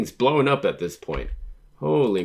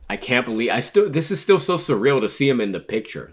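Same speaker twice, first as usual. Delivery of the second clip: raised, loud burst of disbelief; amazed; 5 semitones higher, 7 dB louder than usual. Real, not acted. Reader reacting to a surprise in the manga, amazed. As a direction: blurt it out in excited disbelief.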